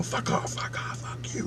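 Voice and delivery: yelling under the breath; hushed, breath-only imitation of shouting; whispered mock yell. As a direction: act out yelling in a voiceless whisper. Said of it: "I start yelling".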